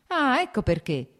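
sudden high, loud outburst; shouting. A narrator's reading. Nikolai realising his brother's motive, shouting. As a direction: burst out as it suddenly clicks. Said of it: "gridò ad un tratto Nikolai".

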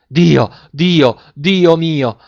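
loud, high cry of shock; three-fold cry. A narrator's reading. Mother seeing her lost son appear, crying out. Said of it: "La donna urlò tre volte".

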